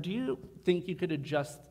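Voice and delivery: ordinary talk, nothing marked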